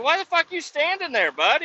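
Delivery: retold high-pitched yell at the coworker; incredulous yell. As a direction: yell in disbelief at the soaked guy. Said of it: "I'm like".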